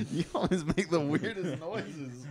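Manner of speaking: slow, laughing through the words; giggling at his cohost's noise. Real, not acted. Host laughing at his cohost's weird noise, slow and giggly.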